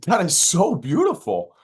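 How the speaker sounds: high, loud, drawn-out admiration; acted gushing compliment. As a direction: gush slowly to a stranger about their work. Acted compliment to a mural painter.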